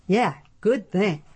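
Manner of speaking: slow, dry amused agreement; hiding a smile. A narrator's reading. Flick humoring Adam, slow and dry, hiding a smile. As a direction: hold back a grin as you humor him. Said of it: "tried to hide a smile".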